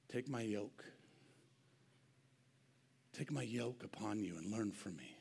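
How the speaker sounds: soft, low, tender invitation; tender hushed voice of Jesus; gentle Jesus. Acted.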